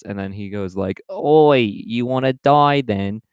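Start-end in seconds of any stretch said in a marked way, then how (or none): 1.1-3.2 loud, high, slow mock British menace; mock British threat. Tracksuit guy threatens the friend, slow mock British menace. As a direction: threaten like a cartoon British hooligan. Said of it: "I don't know why he's British".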